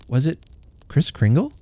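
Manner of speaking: plain reading voice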